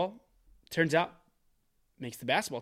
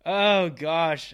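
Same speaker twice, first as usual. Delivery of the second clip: usual speaking voice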